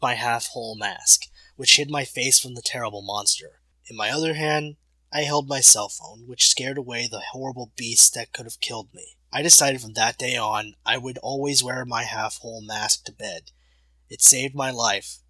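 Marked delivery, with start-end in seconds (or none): none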